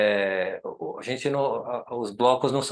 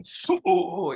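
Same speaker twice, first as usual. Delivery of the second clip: higher, drawn-out mock celebration cry; Cristiano Ronaldo goal celebration; 6 semitones higher, much slower than usual. Architect imitating a football star celebrating a goal, mock cry. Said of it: "quando ele faz gol, ele faz assim".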